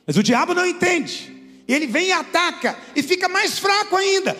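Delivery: preaching at full force. Acted. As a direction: preach it out to the congregation. Preaching climax.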